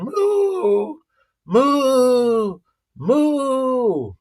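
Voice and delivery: high little calf moos; calf mooing. Retold or imitated. Calf following the mama cow, high mooing. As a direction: moo like a small calf calling its mama. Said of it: "follows the mama ... cow saying".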